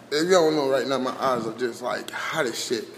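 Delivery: soft, strained voice; strained and quiet. Real, not acted. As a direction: push it out quietly through the burn. Eyes burning, voice fades.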